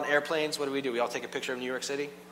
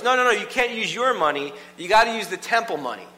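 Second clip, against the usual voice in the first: raised, officious refusal; officious priest; 7 semitones higher, 6 dB louder than usual. Retold or imitated. The priest refuses ordinary money, loud and officious. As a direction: correct him high and loud. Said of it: "the priest goes".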